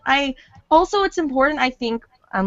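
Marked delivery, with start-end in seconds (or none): none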